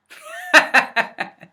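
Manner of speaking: high, breathy burst of laughter; real laugh. Real, not acted. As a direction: crack up at your own story. Laughs at his own punchline.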